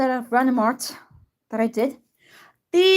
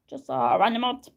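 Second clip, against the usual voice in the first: fast whisper to self; quick whisper; 7 semitones lower, 11 dB quieter, much faster than usual. Real, not acted. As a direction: rush it out under your breath. Muttering while saving art.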